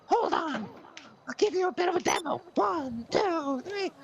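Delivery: very high, loud, cracking plea; high loud wail of a needy patient; desperate patient voice. Acted. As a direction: squeal it like a frantic little man. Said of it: "Mister Pavlovich is like".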